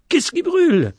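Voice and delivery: high, alarmed cry after a cough; choking alarm. A narrator's reading. Boy choking in smoke, high alarmed cry. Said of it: "Il se mit à étouffer, à tousser".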